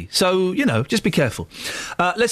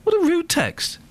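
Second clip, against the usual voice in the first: high, indignant disbelief; outraged at a rude text; 7 semitones higher than usual. Real, not acted. Radio host reacts to a listener text.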